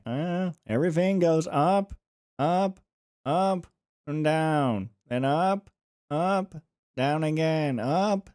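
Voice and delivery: words swooping up, up and down in a singsong; old newsreel singsong. Shown as a demonstration. Mocking how old-time announcers spoke, with rising singsong. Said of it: "this is how they spoke back in the day".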